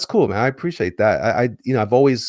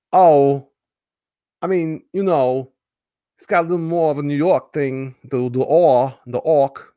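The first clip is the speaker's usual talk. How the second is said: slow, higher Walken-style drawl; Christopher Walken impression; 6 semitones higher, 7 dB louder than usual. Streamer riffs on Walken's voice for chat, slow and exaggerated. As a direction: play a halting New York Walken. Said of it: "That's more walking than Obama".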